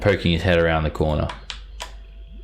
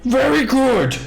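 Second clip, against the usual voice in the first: greedy giant; slower than usual. Acted. Two-headed giant asking the party for shiny treasure. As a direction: bargain like a simple-minded brute hungry for treasure.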